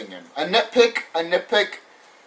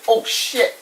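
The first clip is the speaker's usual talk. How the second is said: mock shock; 6 semitones higher than usual. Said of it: "All I have to say is".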